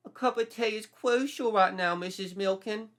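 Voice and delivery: high, hammed-up urgent Brit; fussy British guest. A narrator's reading. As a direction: insist on tea as if vital.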